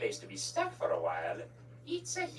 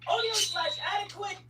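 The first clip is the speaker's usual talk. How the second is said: loud announcement; 4 dB louder than usual. Acted. Announcing editing effect dramatically.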